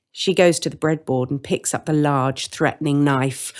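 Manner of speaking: usual speaking voice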